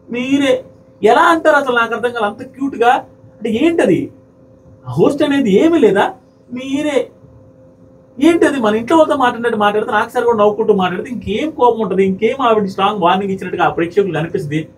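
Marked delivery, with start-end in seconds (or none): 0.1-10.4 higher, slowed indignant outburst; real anger. Real, not acted. Reviewer fuming at the contestant's disrespect to the host, angry. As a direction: demand an answer, heated and disbelieving. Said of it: "భయంకరమైన కోపం వచ్చింది".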